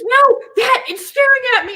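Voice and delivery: high, laughing frightened whine; scared whine. Retold or imitated. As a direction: insist in alarm. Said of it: "He goes".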